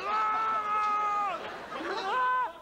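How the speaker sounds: high loud frantic yelps; panicked yells. Retold or imitated. Looking back at mates as the wave hits, frantic yelps. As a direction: yell as the wave arrives.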